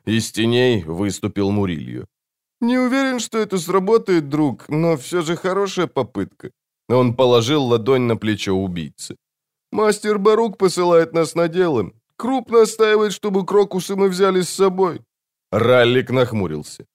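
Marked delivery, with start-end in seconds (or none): 2.6-6.5 high-pitched, light character voice; assassin's friend. A narrator's reading. Friend steps from the shadows to the assassin, high-voiced. 9.7-15.0 high-pitched character voice throughout; assassin's friend. A narrator's reading. Friend relays the master's orders to the assassin, high-voiced.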